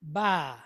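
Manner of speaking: short "Ba" like a bleating sheep; "Ba" said as a sheep sound, B plus open ah. Shown as a demonstration. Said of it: "the sound that a sheep makes".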